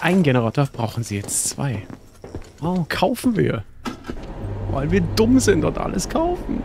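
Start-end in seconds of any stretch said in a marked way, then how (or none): 2.6-6.6 high mocking voice; mock the gullible buyer; sarcastic.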